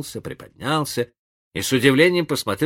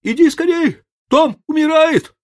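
very high, loud urgent cry; frantic shout; 8 dB louder than usual. A narrator's reading. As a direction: shout for help in panic. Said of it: "закричал он".

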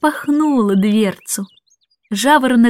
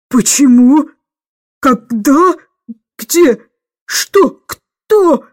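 hoarse, choking questions; 3 semitones higher, 5 dB louder, much slower than usual. A narrator's reading. Miss Andrew finding the cage empty, hoarse disbelief. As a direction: choke out each word, louder and rising. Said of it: "прохрипела она".

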